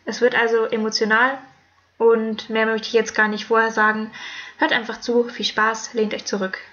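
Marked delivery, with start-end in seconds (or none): none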